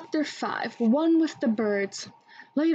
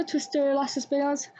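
usual speaking voice

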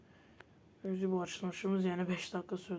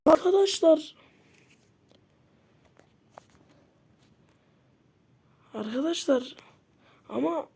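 high, loud exasperated cry; angry outburst; 8 semitones higher, 9 dB louder than usual. Real, not acted. Gamer shouting in anger at viewers after a loss. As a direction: vent your fury at the viewers. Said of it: "çok sinirlendim".

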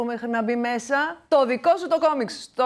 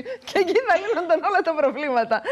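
laughing through the punchline, higher; 7 semitones higher, 3 dB louder than usual. Real, not acted. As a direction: say it while laughing, voice riding up. Laughs at own joke. (γελώντας, με ψηλότερη φωνή)

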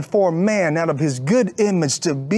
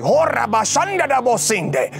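unknown tongue, slightly higher, rolling syllables; speaking in tongues; 4 semitones higher than usual. Retold or imitated. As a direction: pour out strange syllables as if in tongues. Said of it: "I start speaking in different languages".

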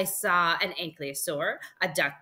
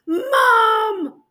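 very high, loud, long shout; yelling for Mom; 12 dB louder than usual. Acted. Boy seeing a flying dinosaur, yelling for mom. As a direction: holler excitedly for your mother. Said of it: "I yelled".

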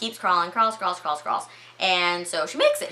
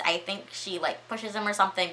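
usual speaking voice

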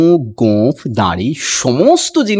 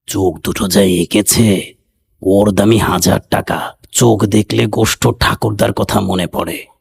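put-on lower, older uncle voice; 7 semitones lower than usual. A narrator's reading. Old uncle praises the portrait's eyes, in a lower old man's voice. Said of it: "এক একটা character আছে এখানে".

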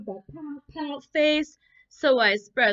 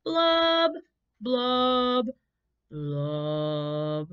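sad blub blub; 3 semitones higher, 5 dB louder, much slower than usual. Acted. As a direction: let each blub sink down. Refrain again.

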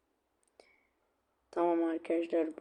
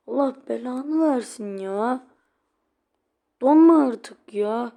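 higher, louder furious curse; angry shout; 6 semitones higher, 10 dB louder than usual. Real, not acted. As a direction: vent the rage out loud. Cursing frozen game. (oyuna öfkeyle küfreden yayıncı)

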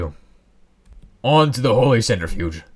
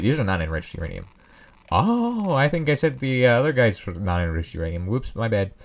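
ordinary talk

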